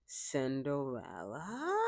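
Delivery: slow, hushed, drawn-out villain call; evil stepmother. Retold or imitated. The evil stepmother calling Cinderella, slow and hushed. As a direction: purr the name out slowly like a cold villain. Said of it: "The evil stepmother from Cinderella".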